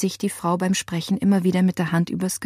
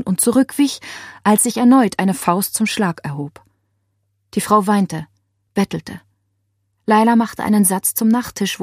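ordinary delivery

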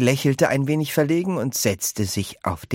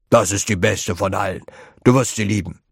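put-on louder old man, enthusiastic; old grandpa voice; 3 dB louder, faster than usual. A narrator's reading. Grandpa George promises Charlie he'll love it, louder.

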